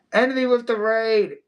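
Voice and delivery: high, drawn-out shout-out; excited callout. Real, not acted. Streamer greeting an incoming raid, excited callout. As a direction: call it out high and slow.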